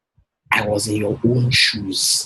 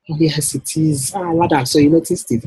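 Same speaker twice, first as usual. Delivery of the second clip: client's delighted voice; 7 semitones higher, 3 dB louder than usual. Acted. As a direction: play the flattered woman who is thrilled someone noticed. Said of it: "When my wife sees me talking like this".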